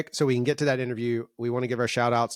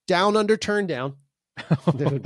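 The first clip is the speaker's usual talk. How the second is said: normal talking voice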